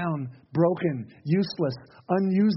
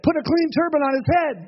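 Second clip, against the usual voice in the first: higher, eager ringing order; emphatic command; 9 semitones higher, 4 dB louder than usual. Acted. Prophet calling out to those standing by, emphatic.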